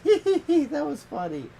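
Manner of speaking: put-on high, loud tee-hee kid voice; giggling toddler. Friend imitating a toddler who walked away unharmed, giggly. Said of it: "toddler just walks away unharmed and goes".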